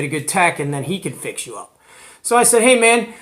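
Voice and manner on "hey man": ordinary talk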